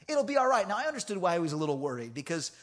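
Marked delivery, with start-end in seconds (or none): 0.1-0.7 higher, firmer retold reassurance; insistent reassurance. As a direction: insist to a worried kid that all is fine. Repeats the reassurance more forcefully.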